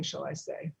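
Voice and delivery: ordinary talk, nothing marked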